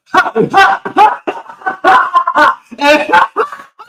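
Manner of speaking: a real loud laugh; loud high laugh. Real, not acted. Bursts out laughing at the joke.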